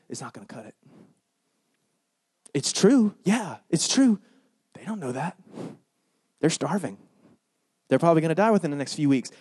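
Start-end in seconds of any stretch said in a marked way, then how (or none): none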